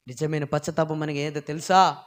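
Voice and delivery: ordinary talk, nothing marked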